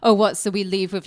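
usual speaking voice

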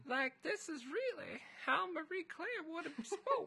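put-on high old lady, half laughing; granny voice through a laugh. Shown as a demonstration. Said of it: "in this voice".

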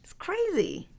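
high, stunned "what" rising into a squeal; disbelief. Retold or imitated. Host recalling her reaction to the film, high disbelieving exclaim. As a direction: react as if you can't believe it. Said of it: "I'm like".

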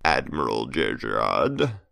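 put-on slow gravelly old villain, smirking; gravelly Emperor voice. A narrator's reading. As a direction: rasp it out slowly with a smirk. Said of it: "the Emperor said with a gravelly voice and a smirk".

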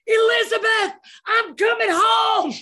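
hammed-up deathbed call to heaven; dramatic dying cry. Collapsed on the hospital counter, a hammed-up cry to heaven. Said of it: "I'm like".

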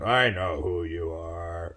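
hammed-up slow deep rumble; slow deep Kissinger reply. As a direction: drag it out low like an old statesman. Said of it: "Like that".

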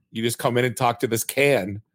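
ordinary delivery

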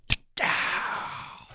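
a soft, breathy "tick-ow" like a far-off rifle crack; imitated rifle shot. Hunter mimics the far-off shots he heard at dawn, soft breathy gunshot sound. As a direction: mouth the sound of a distant gunshot. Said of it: "I hear".

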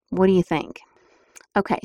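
ordinary delivery

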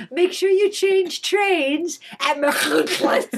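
put-on slow, mannered British announcer accent; lightly imitating a British train announcement. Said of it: "this beautiful British accent".

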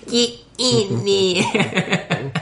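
very slow, high stretched Aussie; Australian accent. Retold or imitated. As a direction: drag out a hammy Aussie order. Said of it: "So terrible".

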